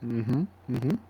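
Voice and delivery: humming through a mouthful of food; mouth full. Real, not acted. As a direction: mouth full while agreeing. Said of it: "Sorry, I got food in my mouth".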